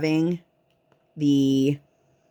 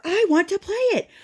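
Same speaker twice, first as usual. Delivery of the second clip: high-pitched mock tantrum; squealed kid protest, pitched way up; screaming child; 14 semitones higher than usual. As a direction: imitate a toddler wailing demands. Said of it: "Just screaming for an hour straight".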